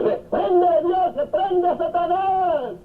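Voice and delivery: high, laughing mock exorcism; a hammed-up cry to drive Satan out; laughing mock rebuke.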